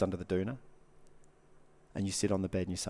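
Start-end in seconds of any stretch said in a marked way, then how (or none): none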